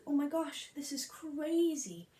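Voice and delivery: high, drawn-out exaggerated disbelief; mock astonishment. Captain reacting to the concert news, in mock astonishment. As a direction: play up the shock, stretching the words.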